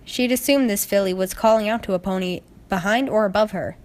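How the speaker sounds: usual speaking voice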